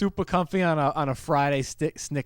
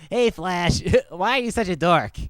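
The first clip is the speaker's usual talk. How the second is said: Meathead impression; 3 dB louder than usual. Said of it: "our best Meathead impression".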